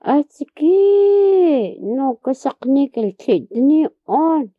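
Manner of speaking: high, loud, drawn-out alarmed cry; old woman crying out in alarm; frightened cry. Retold or imitated. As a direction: cry out in fright, stretching the first word. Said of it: "she just jump and he say".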